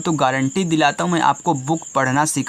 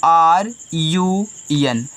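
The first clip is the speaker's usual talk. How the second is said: letters spelled out slowly, one by one; slow spelling; much slower than usual. Shown as a demonstration. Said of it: "आगे देखिए". (अक्षर धीरे-धीरे बोलना)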